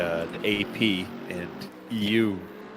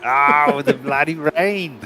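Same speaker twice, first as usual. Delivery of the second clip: mock Aussie; 5 semitones higher, 7 dB louder, slower than usual. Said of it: "Aussie greatness".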